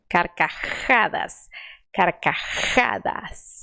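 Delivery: slow "Carcajadas" with a forceful rasped J; strong J. Shown as a demonstration. Teacher drills the strong Spanish J. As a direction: push the J in "Carcajadas" hard. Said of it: "colocando força".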